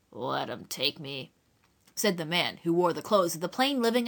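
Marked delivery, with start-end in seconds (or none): 0.1-1.3 low, slow, resigned man; stranger's voice. Acted. Stranger to the children, low and unbothered.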